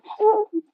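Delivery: high-pitched mock giggling; imitated giggle. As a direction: giggle up high like someone stifling mischief. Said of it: "Mike over there just giggling".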